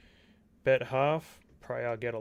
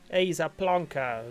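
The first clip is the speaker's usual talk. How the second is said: louder, slower plonker in a put-on British accent; mock British accent; 4 semitones higher, 6 dB louder, slower than usual. Australian streamer imitating the British, mock British accent. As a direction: ham up a British accent, slowly. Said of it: "as the British would say".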